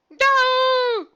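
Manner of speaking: very high, loud terrified yell; bellow. Acted. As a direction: yell in terror of his life. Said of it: "bellowed Lefty".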